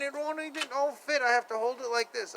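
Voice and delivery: ordinary delivery, nothing marked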